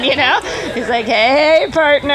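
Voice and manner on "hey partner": higher, playful mock come-on; hammed-up flirty greeting, raised pitch; flirty imitation. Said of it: "winking at me. He's like".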